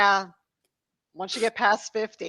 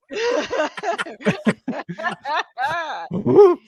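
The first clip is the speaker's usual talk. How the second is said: laughing; 7 semitones higher, 4 dB louder, much slower than usual. Real, not acted. Still laughing after the joke.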